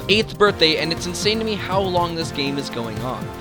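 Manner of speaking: ordinary delivery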